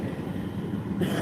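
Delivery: soft, breathy run of chuckles; laugh. Real, not acted. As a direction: laugh quietly at your own story. Laughs at own telemarketer story.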